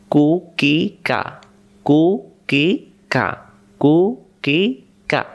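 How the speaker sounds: কুকিকা stretched slowly, syllable by syllable; teacher drills কুকিকা slowly for students to repeat. Shown as a demonstration. ধীরে ধীরে কুকিকা. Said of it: "drilling syllables".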